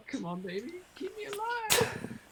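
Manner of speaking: playful high-pitched begging; pleading with the dice. Pretend. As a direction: coax the dice in a high, stretched plea. Coaxing the dice before a roll.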